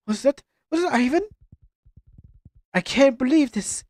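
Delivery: acted game dialogue. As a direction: read the cutscene lines with mild hurt. Voices game dialogue scene.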